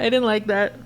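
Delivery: ordinary talk, nothing marked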